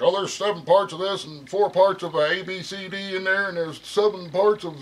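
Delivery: lightly voiced know-it-all rattling off a recipe; imitating Buzzsaw. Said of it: "he was like".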